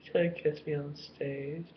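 put-on high, slow, teasing girly voice; mock Charlize voice.

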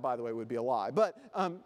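ordinary delivery

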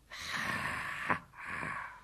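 slow breath-only chuckle; snake chuckle. A narrator's reading. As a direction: chuckle voicelessly like a python. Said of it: "said Kaa with a chuckle".

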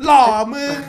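loud teasing shout; a big joking cheer; excited shout. Real, not acted. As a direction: yell it out, laughing at your friend. Friends teasing handsome voice.

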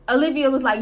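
usual speaking voice

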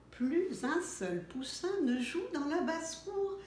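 ordinary delivery, nothing marked

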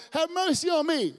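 high, loud cry, Son of David; blind man crying. Acted. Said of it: "Blind Bartimaeus".

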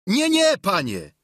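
high, loud squire's refusal; Sancho. A narrator's reading. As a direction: burst out refusing in a high servant voice. (głośna odmowa wysokim głosem)

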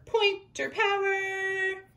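high, bright cheer for pointer power; excited rally. Real, not acted. Teacher cheering her class on, high and excited.

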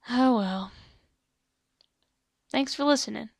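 resigned gunslinger sign-off, still loud. Acted. Gunslinger thanking listeners after losing the barkeep, shrugging it off. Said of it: "a gunslinging kind of voice like this".

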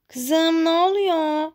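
a mother calling from downstairs; concerned mother. Acted. Mother hears shout.

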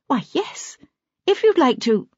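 put-on polite, slightly nervous young woman; Mrs Quayle's voice. A narrator's reading.

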